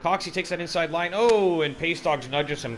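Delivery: high, loud, building race call; excited play-by-play. Real, not acted. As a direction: call the battle at full pitch. Wheel-to-wheel battle called live.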